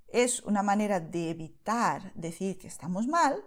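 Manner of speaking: usual speaking voice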